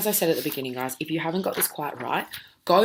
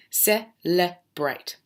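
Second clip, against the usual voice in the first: very slow model word; much slower than usual. Shown as a demonstration. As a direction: Celebrate drawn out slowly. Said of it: "end in a vowel".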